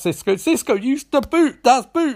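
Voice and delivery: high, urgent mock yell; half-shouted command, played for laughs; retold shout. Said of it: "Joe shouts".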